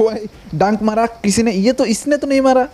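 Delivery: high-pitched startled protest; startled yelp. Real, not acted. Stung by a scorpion mid-game.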